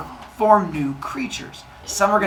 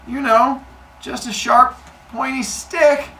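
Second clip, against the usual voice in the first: fake innocent; 7 semitones higher, slower than usual. Cronus feigns innocence.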